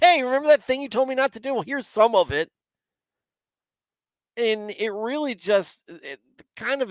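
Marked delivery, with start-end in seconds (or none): 0.0-2.5 high, fast, cheeky tone; sarcastic mock offer. Speaker mocking Saul offering forbidden animals to God, high and sarcastic. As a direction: parody the king's cheeky gift.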